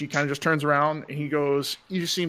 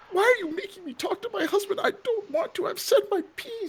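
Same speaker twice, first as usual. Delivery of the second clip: pitched-up refusal; whiny noblewoman pleading; high indignant wife; 3 dB quieter than usual. Acted.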